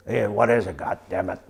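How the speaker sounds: a light take on the big colonel's raspy voice; imitating the raspy colonel. A veteran retells how the colonel talked to him, lightly raspy. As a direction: retell his raspy question with a touch of his voice. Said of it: "That's how he talked to me".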